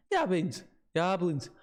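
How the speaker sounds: put-on old local man's northern English with Scots touches; rustic northern English, slipping toward Scottish; northern English dialect. Old man scoffing to visitors about warnings, northern English dialect. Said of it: "like a very northern English".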